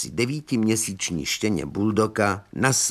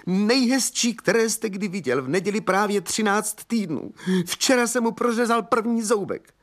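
high, overwrought outburst; agitated insistence; 11 semitones higher than usual. A narrator's reading. Young man describes his lost baby, agitated. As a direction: pour it out high and emotional.

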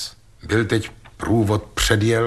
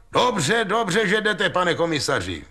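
much higher, eager call to the commissioner; 9 semitones higher than usual. A narrator's reading. Ostrohradský calls out in relief to the arriving commissioner. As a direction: hail the officer from across the street. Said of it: "volal Ostrohradský na přicházejícího".

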